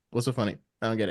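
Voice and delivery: low, soft imitation of a puzzled director; understated mock bafflement, dropped low. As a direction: quote him quietly, lightly baffled. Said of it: "he'd be like".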